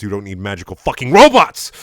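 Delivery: building to a loud yell on the last word; rant, then burst out loud; outraged shout at the end; exasperated rant. Acted.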